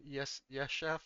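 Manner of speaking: small, soft hesitant answer; mild acted nervousness; nervous. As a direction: answer the boss timidly, questioning at the end. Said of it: "Linguini nervously will come in".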